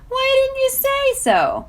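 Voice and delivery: very high, slow, delighted; suddenly friendly and bright; cheerful. A narrator's reading. Face breaks into a grin.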